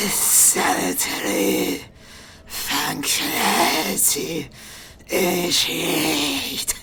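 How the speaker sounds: slow, whispery mechanical hiss. Acted. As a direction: hiss each word slowly like a machine priest. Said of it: "in a hissing voice".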